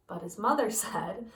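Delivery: ordinary delivery, nothing marked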